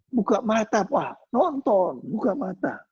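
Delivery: put-on high-pitched pleading kid; imitated urging child. Child telling father to open his eyes and watch, high urging.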